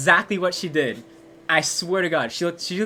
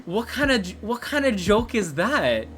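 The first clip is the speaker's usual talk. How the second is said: retold protest, pitched up and incredulous; mock indignation; 5 semitones higher than usual. Boy answering her joke excuse, indignant disbelief.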